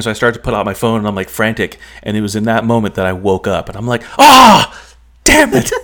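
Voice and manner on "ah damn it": high, loud "Ah, damn it"; frustrated outburst. Retold or imitated. Woken from a dream too soon, a loud frustrated cry. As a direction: explode in frustration at waking up. Waking up before the dream ends.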